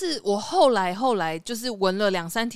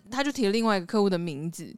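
ordinary delivery

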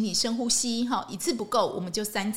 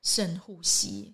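slow deep breath; 4 semitones lower, 4 dB quieter, much slower than usual. Shown as a demonstration. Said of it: "第一件事情要干嘛？".

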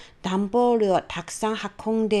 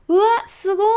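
put-on much higher, louder child cry of wonder; delighted gasp; 9 semitones higher, 6 dB louder, much faster than usual. Child thrilled by the sparkling castle lights, high and loud. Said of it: "大喜び".

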